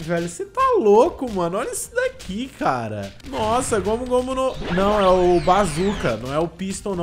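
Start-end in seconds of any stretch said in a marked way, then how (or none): 0.3-3.1 loud high astonished yell; amazed. Real, not acted. Amazed by attack animation. 3.3-6.2 loud thrilled exclamation; excited. Real, not acted. Amazed by Bazooka attack.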